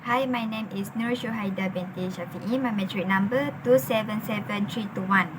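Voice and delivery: usual speaking voice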